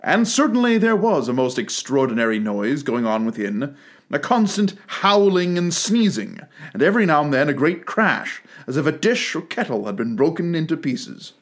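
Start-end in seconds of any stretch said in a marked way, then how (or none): none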